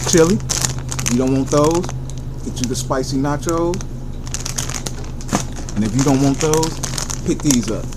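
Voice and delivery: ordinary delivery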